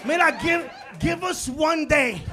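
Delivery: slow, loud, pleading rant; loud comic plea. Real, not acted. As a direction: beg the crowd for one day, slowly.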